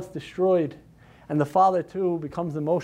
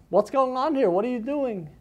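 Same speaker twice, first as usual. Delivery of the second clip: shocked demand; 7 semitones higher than usual. Acted. Wife confronts drunk men.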